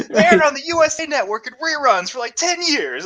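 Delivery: high mock-indignant cartoon rant; Quagmire. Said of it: "just absolutely appalled".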